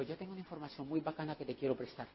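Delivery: fast, low, hushed aside; confidential offer. Acted. Recruiter privately offering information to a guest, hushed and confidential. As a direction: slip it privately so others miss it. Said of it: "no le hablo enfrente de los demás".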